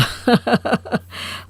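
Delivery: airy chuckle; light breathy laugh. Acted. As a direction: laugh softly and fondly. Father laughs at daughter-in-law. (हल्की सी हँसी)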